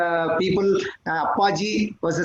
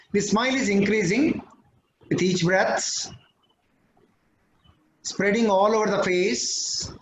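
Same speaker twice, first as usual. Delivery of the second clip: guided meditation. Acted. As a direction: guide the group gently through breaths. Leads a breathing meditation.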